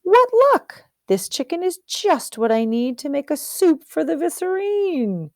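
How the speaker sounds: the cook can't believe his luck; delighted cook. Acted. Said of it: "The cook who saw him said".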